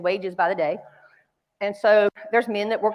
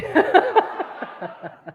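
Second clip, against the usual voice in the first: high burst of real laughter; big laugh; 11 semitones higher, 4 dB louder than usual. Real, not acted. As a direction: laugh out loud at the punchline. Laughing at her own luggage mix-up.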